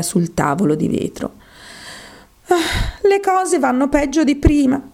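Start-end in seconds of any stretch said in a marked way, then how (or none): 2.5-4.8 dismayed. A narrator's reading. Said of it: "disse la povera bambina".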